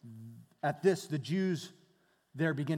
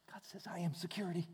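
unvoiced whisper of God's words; 9 dB quieter, faster than usual. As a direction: breathe it out as a whisper. Whispered answer from God.

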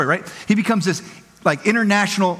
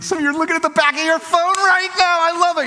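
higher and excited, amused at the crowd; delighted; 10 semitones higher than usual. Real, not acted. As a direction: delight in catching them out. Delighted at audience checking phones.

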